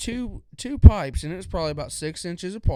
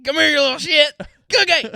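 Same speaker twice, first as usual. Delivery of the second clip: high, loud cursing holler at a dog; imitated exasperated yell; 11 semitones higher, 10 dB louder than usual. Worker imitating boss calling his runaway dog, high exasperated mock yell. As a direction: bellow after the runaway pet, hammed up. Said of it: "and he'd go".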